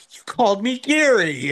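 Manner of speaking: high, indignant mock protest; acted-out teasing indignation; mock accusation.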